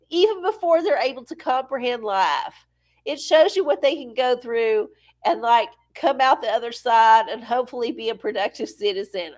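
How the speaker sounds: usual speaking voice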